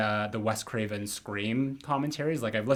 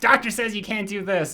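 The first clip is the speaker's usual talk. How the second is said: imitating a scolding woman; 10 semitones higher, 4 dB louder than usual. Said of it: "she's just like".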